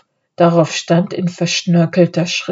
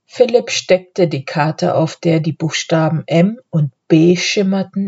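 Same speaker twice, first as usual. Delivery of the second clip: ordinary delivery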